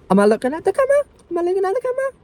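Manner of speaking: squeaky high-pitched question. Real, not acted.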